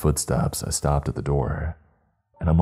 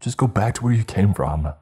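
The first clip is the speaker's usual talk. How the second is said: higher-pitched, firm dismissal; shouted; 5 semitones higher than usual. A narrator's reading. Aiden shielding Kyle, shouting at the voice. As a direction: send it away, voice raised. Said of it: "Aiden shouted".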